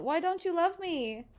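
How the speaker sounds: high, whiny pleading question; mock sad. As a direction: beg for love like a child.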